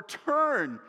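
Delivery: sharp, high, loud command; urgent shout. Retold or imitated. Dad shouting at his teen driver, loud urgent command. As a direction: bark an order at a learner driver. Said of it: "I tried to explain to them when... imminent danger".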